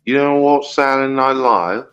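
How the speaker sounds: ordinary talk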